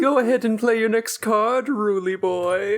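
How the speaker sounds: a hint of a gloating clown; taunting villain voice. Voicing the clown character.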